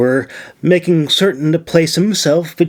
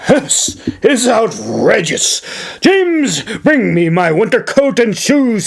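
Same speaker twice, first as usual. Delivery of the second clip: commanding governor; 8 semitones higher than usual. Acted. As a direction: bark orders at the servant, pitched up. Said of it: "he commanded".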